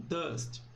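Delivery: "dust" said the English way, with an open A-like vowel; English pronunciation. Shown as a demonstration. Teacher models the right English word for students, carefully. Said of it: "Ela se pronuncia dust".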